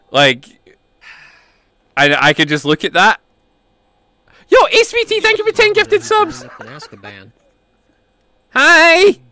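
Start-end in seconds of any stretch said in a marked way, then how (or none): 4.5-9.2 high cute streamer voice; bright high thank-you to chat; cute anime streamer. Acted.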